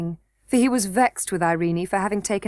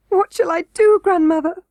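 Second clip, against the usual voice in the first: much higher and louder, tearful; sobbing; 8 semitones higher, 7 dB louder than usual. A narrator's reading. Princess to her grandmother, sobbing and high. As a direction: cry it out in despair. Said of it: "sobbed the princess".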